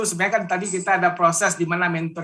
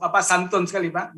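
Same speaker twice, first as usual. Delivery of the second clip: ordinary talk, nothing marked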